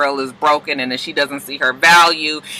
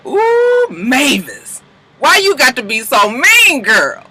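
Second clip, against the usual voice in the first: mock shock; 6 semitones higher, slower than usual. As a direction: scold her in playful shock. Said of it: "I said".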